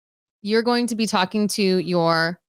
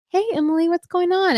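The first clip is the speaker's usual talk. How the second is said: chirpy planner voice; 9 semitones higher than usual. Retold or imitated.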